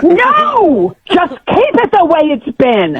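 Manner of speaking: high, wailing protest; upset customer losing patience; very worked up. Real, not acted. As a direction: start on a high outraged cry, then plead crossly. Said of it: "you're getting very worked up".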